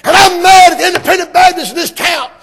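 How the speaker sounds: high, loud, shouted "I'm mad"; impersonating a fiery preacher. Retold or imitated. Doctor Hudson shouting from his pulpit tape. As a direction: bellow it like an angry pulpit preacher. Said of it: "He said".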